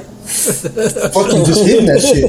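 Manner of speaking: slow, laughing delivery; laughing while telling. Real, not acted. Telling friends about the hit, laughing. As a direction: chuckle through the words.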